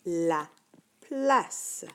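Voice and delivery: teacher modelling the word; careful reading. Shown as a demonstration. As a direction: model "La place" clearly. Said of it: "Écoute bien".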